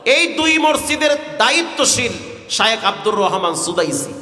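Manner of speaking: ordinary delivery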